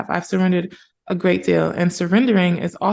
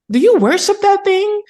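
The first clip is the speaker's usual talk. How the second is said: put-on much higher, louder old-lady voice; grandma aghast, much higher and louder; alarmed grandma; 13 semitones higher, 6 dB louder than usual. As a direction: play the horrified grandmother asking. Grandma's alarmed question retold.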